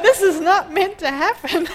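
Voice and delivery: laughing through the line in disbelief. Retold or imitated. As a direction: say it while cracking up. Retold thought amid laughter.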